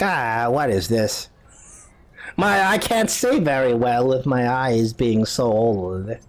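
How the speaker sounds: put-on slow old woman peering at something; old lady. Old lady squints at the insignia, slow old lady voice. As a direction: draw it out like an aged woman. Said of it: "She's like".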